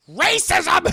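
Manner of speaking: loud, high comic yell; mock-outraged shout. Streamer calls out the movie, loud mock-outraged shout.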